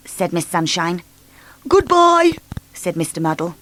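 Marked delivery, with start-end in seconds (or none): 1.7-2.4 high, silly mixed-up greeting; muddled cheerful voice. A narrator's reading. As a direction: say it brightly, all wrong.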